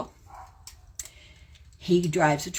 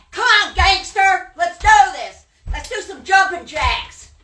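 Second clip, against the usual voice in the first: high shouted jeer; mocking taunt; 11 semitones higher, 5 dB louder than usual. Real, not acted. Daring her rival on the live stream with a high jeering shout. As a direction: egg him on, loud and high.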